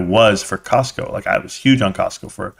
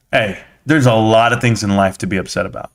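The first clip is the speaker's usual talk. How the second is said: ordinary talk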